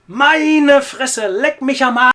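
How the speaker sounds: high, very loud German swearing; cathartic shouting. Acted. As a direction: let rip and yell it to blow off steam. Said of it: "than shouting".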